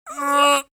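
high, loud agonised groan; pained cry. A narrator's reading. Chance's body tearing as he changes, groaning. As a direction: cry out as pain takes over.

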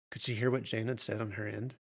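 plain reading voice

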